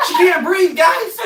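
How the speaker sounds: high, loud wail after a sharp gasp; mock crying gasp. Replaying the winded cousin act, high mock cry.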